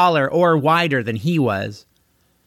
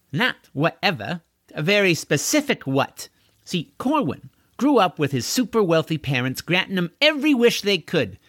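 lightly voiced, higher-pitched know-it-all; self-assured thief; 6 semitones higher than usual. Thief explaining how she robbed the castle owner, lightly cocky. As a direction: explain the scheme eagerly in a raised pitch. Deirdre continues her explanation.

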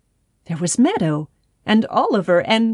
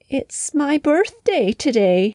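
slow, high, sorrowful hen; sad; 7 semitones higher than usual. A narrator's reading. Hen tells the frog nobody remembered, sadly. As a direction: sigh it out to the frog, heartbroken. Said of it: "Henrietta said sadly".

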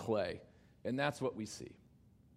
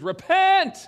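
high, booming call to repent; shouted command; 12 semitones higher, 14 dB louder than usual. Acted. Preacher crying out Jesus's message to sinners, loud shout. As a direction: thunder it out as a command.

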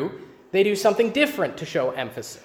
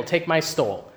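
usual speaking voice

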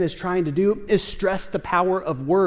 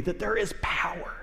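hushed, breathy emphasis on power in words; whisper; 8 dB quieter than usual. Real, not acted. Preacher whispering to the congregation about God's spoken word. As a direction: lean in and whisper it like a secret.

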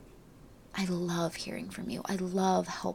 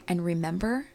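normal talking voice

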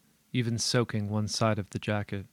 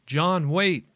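high, loud call after someone; yelling; 8 semitones higher, 6 dB louder than usual. Acted. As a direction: yell it, much higher. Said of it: "I yell after him".

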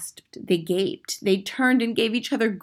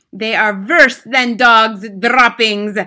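screeching; 4 semitones higher, 12 dB louder, slower than usual. Acted. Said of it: "screeched the Grand High Witch".

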